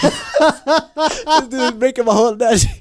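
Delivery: high, loud, cracking up while talking; laughing. Real, not acted. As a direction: protest helplessly through real laughter. Cracking up at friend's joke.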